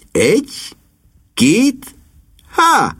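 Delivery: rising count, leaping high on the last; count-in to a call. A narrator's reading. Niece cueing the trio for the hog call, rising. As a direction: count deliberately, then sing out high.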